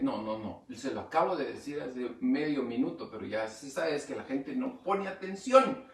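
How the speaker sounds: normal talking voice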